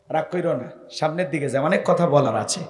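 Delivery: calm him down tenderly; gentle reassurance. Real, not acted. Gently calms the young man.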